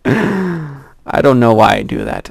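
ordinary talk, nothing marked

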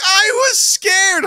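high, loud drawn-out wail; mock upset kid yelling back; hammed-up outraged whine at full volume; imitating mad child. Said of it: "She got so mad at me".

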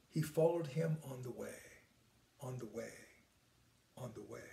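soft, then fading to near silence; repeating a phrase gently into stillness; gently fading repetition. Shown as a demonstration. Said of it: "gently say the words over and over again".

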